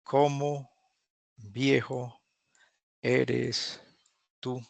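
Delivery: each word said apart, slowly. Shown as a demonstration. To the class, showing the wrong literal way, slow and split. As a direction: translate it word by word, with pauses. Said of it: "No la traduzcan".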